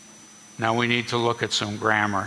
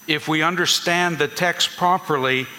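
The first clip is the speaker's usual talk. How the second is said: ordinary talk, nothing marked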